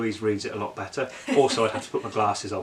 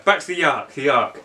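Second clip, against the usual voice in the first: usual speaking voice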